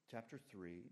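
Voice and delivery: ordinary talk, nothing marked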